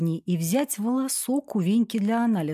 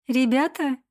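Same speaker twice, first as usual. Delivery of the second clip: higher-pitched hail, not shouted; calling out; 6 semitones higher than usual. A narrator's reading. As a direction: lightly call over to the lads. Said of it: "их окликнула". (лёгкий оклик повыше)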